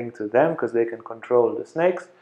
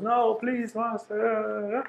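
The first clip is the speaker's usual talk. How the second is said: high, pleading cry to the master; desperate pleading; 9 semitones higher, 8 dB quieter, much slower than usual. Retold or imitated. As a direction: beg him not to push you away. Man pleads with angry master.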